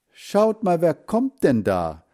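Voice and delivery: put-on child's voice rising high on schaut, then slow; excited call. Girl excitedly pointing into the garden, slow high exclamation. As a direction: Inge calls the others to look at the boy, excited and high. Inge spots a boy in garden.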